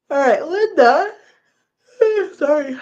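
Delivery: words stretched through a big yawn. Real, not acted. Reading a comment aloud to viewers, yawning. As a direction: yawn right through the name.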